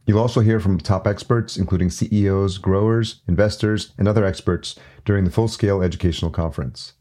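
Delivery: loud, polished sponsor read; punchy promo voiceover with crisp emphasis; podcast ad read. Real, not acted. Host reading conference and podcast promos to listeners, ad read.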